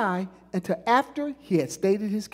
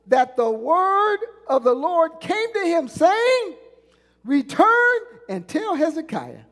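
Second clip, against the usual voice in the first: rising, slow preaching cadence; preaching at full force; 6 semitones higher, 4 dB louder, slower than usual. Acted. Pastor drives the verdict home to the church, fervent preaching. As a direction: build it like a pulpit climax.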